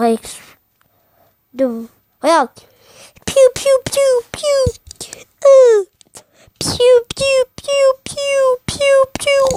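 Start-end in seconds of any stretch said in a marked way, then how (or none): 3.3-9.6 piou piou aigus imités; bruits de tirs. Pretend. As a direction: bruitage de pistolet laser. Imite des tirs de pistolet. (onomatopées de tir aiguës)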